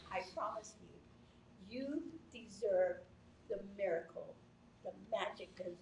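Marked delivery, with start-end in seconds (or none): none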